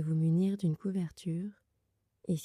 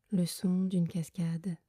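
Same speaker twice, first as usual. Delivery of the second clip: guided meditation. Acted. Leads a Yoga Nidra meditation. (voix douce et lente de méditation guidée)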